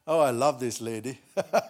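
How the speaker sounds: ordinary delivery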